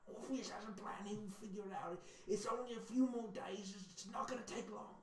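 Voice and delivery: hushed, high-pitched character voice; soft, high murmur of a faithful follower; anxious loyalist voice. Acted. Loyalist reassuring others about King Krem's plan, hushed high voice. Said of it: "some people loyal to King Krem saying".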